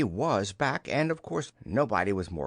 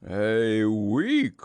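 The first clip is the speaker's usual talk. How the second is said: loud, drawn-out dismay; hammed-up storybook bear gloom; gloomy; 6 dB louder than usual. A narrator's reading. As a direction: groan the words gloomily. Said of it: "said Pooh gloomily".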